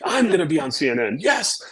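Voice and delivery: mock glee leaping high on the last word; high, triumphant "Yes"; excited intel officer. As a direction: imitate someone thrilled at coming fame. Acting out the thrilled intel officer.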